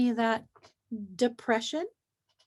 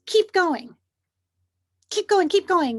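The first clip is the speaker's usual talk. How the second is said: higher and louder, a driving command from the world; pushy demand; 6 semitones higher, 6 dB louder than usual. Retold or imitated. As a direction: push like a relentless boss, keep going. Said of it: "a world that says".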